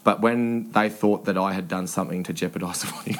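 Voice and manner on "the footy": ordinary delivery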